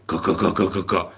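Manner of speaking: mouth-made rattle of fire; imitated war noise. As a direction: knock out a run of shots. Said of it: "is how noisy it is".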